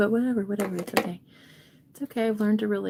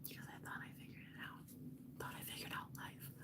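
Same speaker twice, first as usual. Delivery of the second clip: barely audible whisper; tiny whispered admission; hushed confession; 27 dB quieter than usual. Real, not acted. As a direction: drop right down to a whisper. Mock confession dropped to near silence.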